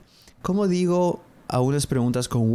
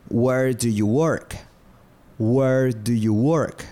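slow, careful "Where do you live?"; slow teaching model; slower than usual. Shown as a demonstration. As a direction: say "Where do you live?" slowly for learners to copy. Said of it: "repeated model question".

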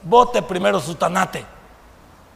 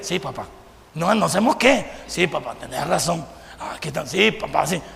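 meek, much softer and lower yes-papa; meek son; 5 semitones lower, 5 dB quieter than usual. Retold or imitated. Son giving in to his scolding father, meek and much softer. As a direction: mumble submissively like a scolded son. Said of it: "Y usted".

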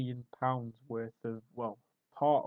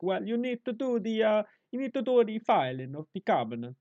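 high, loud mock Italian boss; hammed-up sing-song Italian; Italian accent; 8 semitones higher, 6 dB louder than usual. Italian boss handing out filing, mock Italian accent. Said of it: "the boss comes in and goes".

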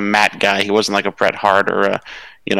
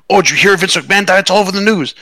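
higher-pitched mock alarm of relatives; relato agitado; 10 semitones higher than usual. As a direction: imitate excited relatives breaking big news, pitched well up. Retells aunt and uncle's excited news.

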